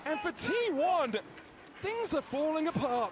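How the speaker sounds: high shouted shoutcaster call; hype casting shout. Acted. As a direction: scream the play as it happens. Casting a big play.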